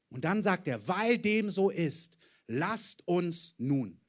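usual speaking voice

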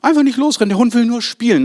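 mocking, lifted voice of a carefree dog owner. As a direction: parodies the owner's casual reassurance. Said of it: "so Sprüche". (spöttisch nachgeahmter Hundebesitzer, mit gehobener Stimme)

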